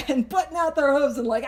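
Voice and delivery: ordinary talk, nothing marked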